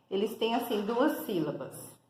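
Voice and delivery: ordinary talk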